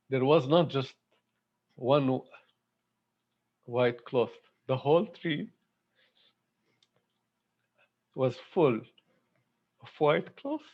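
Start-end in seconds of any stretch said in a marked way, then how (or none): 4.7-10.7 real emotion breaking into the words; choked up with emotion. Real, not acted. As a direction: voice rising and catching, long halting pauses. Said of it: "I don't know why I'm emotional today".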